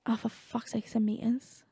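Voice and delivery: whispered grumble to oneself; exasperated mutter. Real, not acted. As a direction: curse softly under your breath. Finds height listed in meters.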